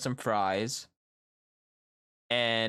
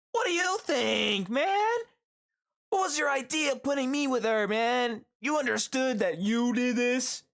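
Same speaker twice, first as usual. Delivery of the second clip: high-pitched mock complaint; acted indignant outburst; 12 semitones higher than usual. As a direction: act out an exasperated protest, pitched way up. Acted angry outburst over radio.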